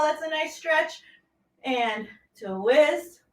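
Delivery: ordinary delivery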